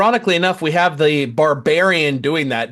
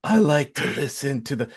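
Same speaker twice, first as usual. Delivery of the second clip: ordinary talk, nothing marked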